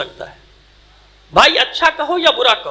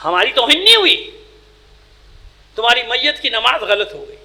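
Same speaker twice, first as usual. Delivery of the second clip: usual speaking voice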